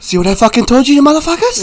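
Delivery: high, loud comic bragging; mock tough-guy taunt. Sniper Mark Wahlberg to victims, comic taunting. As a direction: crow it at them through laughter.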